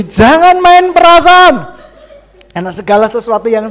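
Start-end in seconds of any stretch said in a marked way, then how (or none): 0.0-1.7 much higher, louder warning cry. Acted. Preacher warns the women, loud cry. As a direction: call it out as a warning.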